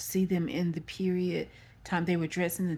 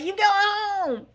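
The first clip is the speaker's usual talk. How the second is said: high, stretched holler; mock staff proclamation, loud and slow; acted-out announcement; 13 semitones higher, 6 dB louder, slower than usual. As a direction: call it out long and high. Repeats staff's discharge announcement.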